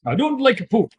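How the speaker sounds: "I don't like poop" yelled high and loud; war cry shout. Acted. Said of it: "let loose your war cry".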